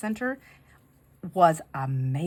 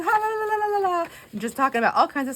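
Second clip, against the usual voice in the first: mock chatter; 12 semitones higher, 4 dB louder, faster than usual. Imitating the group's happy chatter.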